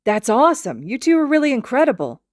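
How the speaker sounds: high, loud eager praise; gushing. A narrator's reading. Zushi praising the two, high excited gush. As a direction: gush with admiration. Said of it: "Zushi gushes".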